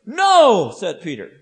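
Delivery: loud, high, drawn-out "No"; strong refusal. Acted. Peter refuses to let Jesus wash him, strong. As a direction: shout a flat-out refusal. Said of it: "if that sounds strong".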